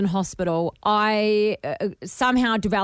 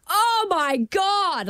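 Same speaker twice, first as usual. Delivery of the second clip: high, drawn-out cry, re-enacting shock; shocked wail, retold; 10 semitones higher, much slower than usual. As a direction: imitate wailing in stunned pain. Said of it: "like, in shock".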